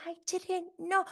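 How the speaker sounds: high mock-innocent voice; mocking innocence. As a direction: parrot her in a high whine. Mocking Monica's claimed innocence.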